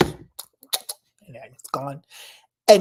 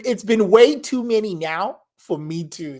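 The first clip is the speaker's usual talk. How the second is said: normal talking voice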